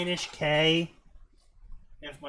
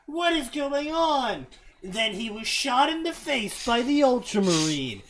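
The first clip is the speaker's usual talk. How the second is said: hammy dramatic narration; 5 semitones higher, 5 dB louder than usual. Acted. Reader performing a cringe fanfic for friends, hammy drama. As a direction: read it out with overblown drama.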